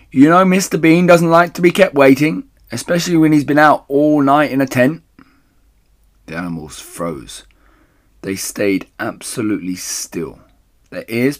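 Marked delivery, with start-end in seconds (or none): 0.1-5.0 high, loud, insistent holler; woman calling out. A narrator's reading. Woman upstairs chiding Mabel about Mister Bean, loud call. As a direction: keep calling from another room. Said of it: "the voice called".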